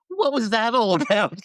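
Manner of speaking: mock puzzled Ike. Ike's puzzled reply in anecdote.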